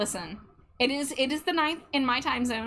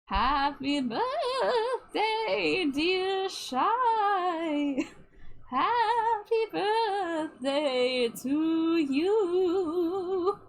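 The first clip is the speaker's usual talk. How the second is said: slow wavering ghostly singing; 6 semitones higher, much slower than usual. Acted. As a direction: sing it like a haunting ghost. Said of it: "You, you want Ghostly?".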